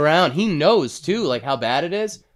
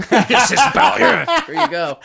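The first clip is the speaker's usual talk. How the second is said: mocking helper; 10 semitones higher, 7 dB louder than usual. Helper mocks uncle's tight belt.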